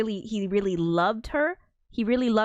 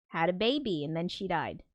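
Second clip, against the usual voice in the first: ordinary talk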